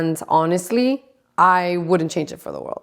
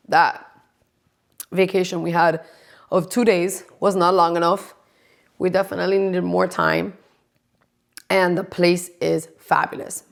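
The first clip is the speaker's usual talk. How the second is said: usual speaking voice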